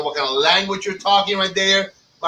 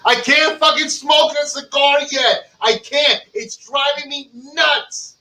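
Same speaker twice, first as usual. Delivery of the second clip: frustrated rant; 8 semitones higher, slower than usual. Real, not acted. Frustrated about not smoking.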